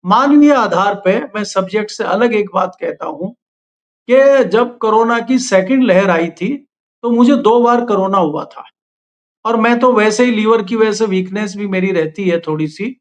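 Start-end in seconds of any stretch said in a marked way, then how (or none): none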